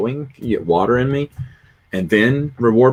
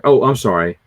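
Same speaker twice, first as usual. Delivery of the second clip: ordinary talk, nothing marked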